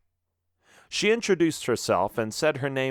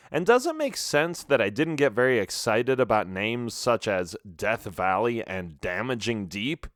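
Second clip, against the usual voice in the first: usual speaking voice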